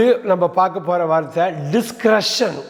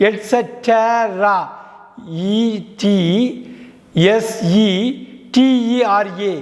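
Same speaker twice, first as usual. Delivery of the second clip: "Etcetera" stretched syllable by syllable; slow demonstration; much slower than usual. Shown as a demonstration. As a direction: break it very slowly into "et-se-te-ra". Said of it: "பாருங்க".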